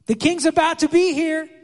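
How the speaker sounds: herald's shout. Retold or imitated. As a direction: announce the coming king to the crowd. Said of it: "they'd be shouting".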